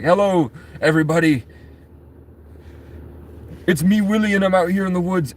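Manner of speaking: high-pitched put-on vlogger greeting; Will Survives. Imagined vlogger greets viewers from the woods, high put-on voice. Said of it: "Will Survives is like".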